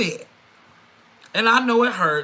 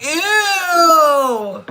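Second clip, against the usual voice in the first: much higher and louder mock disgust; playful disgust at the ex; disgusted ew yuck; 9 semitones higher, 8 dB louder than usual. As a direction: squeal ew, then spit yuck. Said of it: "you gonna be over there like".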